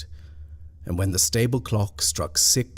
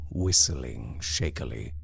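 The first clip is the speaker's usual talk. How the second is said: usual speaking voice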